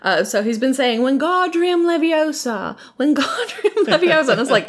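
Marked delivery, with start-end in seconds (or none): 1.0-4.3 WINGARDIUM said as "win-GAH-dree-um", high; high mimicry slurring WINGARDIUM to "win-GAH-dree-um", laughing; Ron. Mimicking a pupil botching WINGARDIUM as "win-GAH-dree-um", high and amused. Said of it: "Ron has a speech impediment".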